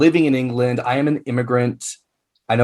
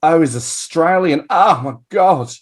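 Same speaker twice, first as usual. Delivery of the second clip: put-on slow, higher, swooning over the accent; drawn-out mock gushing; gushing fan; 4 semitones higher, slower than usual. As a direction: mimic a starstruck admirer. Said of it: "A lot of people go".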